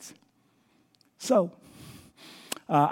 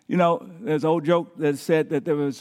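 ordinary delivery

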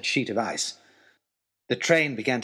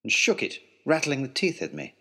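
ordinary delivery

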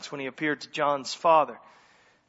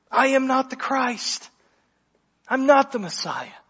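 "not" leaned on in a higher, measured declaration; emphatic denial; 7 semitones higher than usual. Acted. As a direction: firmly deny it, holding out "I am not the Christ". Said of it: "This is emphatic".

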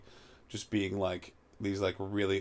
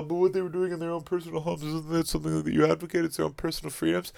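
mocking; 6 semitones higher, faster than usual. Mocking the defenders' argument.

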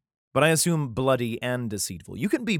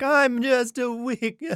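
high, slow, whining mock plea through laughter; mock whiny pleader; 12 semitones higher, 6 dB louder, much slower than usual. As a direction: whimper it like a self-pitying kid, laughing. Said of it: "being like".

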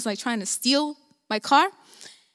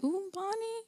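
soft, high scandalized ooh; mock disapproval; 7 semitones higher, 14 dB quieter than usual. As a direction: imitate onlookers gently chiding, hushed and high. Mimics listeners' shocked reaction.

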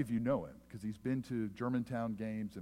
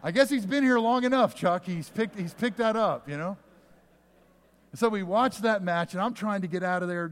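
excited storytelling; 7 semitones higher, 8 dB louder than usual. Real, not acted. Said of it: "excited climax of the story".

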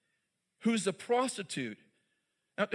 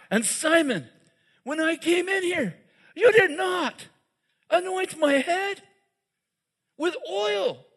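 higher, louder, slowed rebuke; preached rebuke; 9 semitones higher, 6 dB louder, slower than usual. Acted. Jesus rebuking Simon before his guests, loud and higher. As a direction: build to a full preaching cry.

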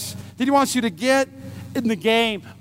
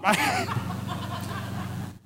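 big higher burst of laughter; real laughter; 9 semitones higher, 8 dB quieter than usual. Real, not acted. Laughs at own fail.

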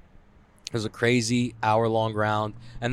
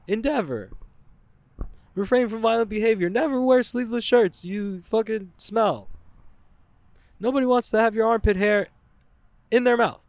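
high, mock-solemn recital of gym rules; mock gym creed; 10 semitones higher than usual. Reciting a gym's creed on the wall, high mocking voice. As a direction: chant the dojo creed like a preachy sign. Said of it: "They're like".

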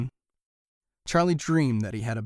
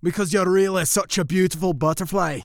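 higher, louder flattering voice; gushing; mice answering; 6 semitones higher, 6 dB louder than usual. A narrator's reading. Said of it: "they answered".

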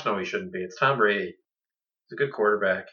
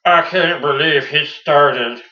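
mock rant; 3 semitones higher, 8 dB louder, much slower than usual. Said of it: "So you would have been like".

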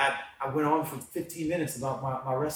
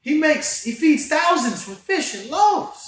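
much higher and louder, preaching with fire; rising into a full excited preach; fired up; 12 semitones higher, 7 dB louder, slower than usual. Real, not acted. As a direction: tell it like you're lighting up the room. Excited preaching about God.

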